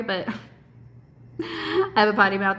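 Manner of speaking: laughing it off; giggling through the words. Real, not acted. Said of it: "I'm not sorry".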